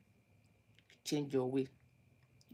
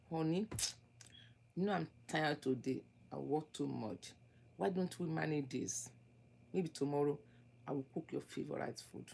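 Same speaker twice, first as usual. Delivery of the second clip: soft, gentle explaining, much quieter; calm manner; 3 semitones lower, 9 dB quieter than usual. Shown as a demonstration. Fiancée calmly explaining to her man she is too tired, soft and gentle. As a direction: soothe him sweetly like a loving fiancée. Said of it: "explain in a calm manner".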